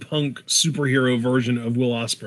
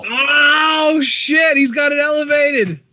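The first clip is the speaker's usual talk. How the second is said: high, loud excited shout; 12 semitones higher, 4 dB louder, slower than usual. Real, not acted. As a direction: yell it out in excitement. Excited reaction to hold.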